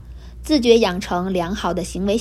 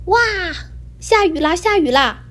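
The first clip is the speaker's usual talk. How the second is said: excited cry; 8 semitones higher than usual. A narrator's reading. Kittens shouting as the rain falls, excited. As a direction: squeal it like startled kittens.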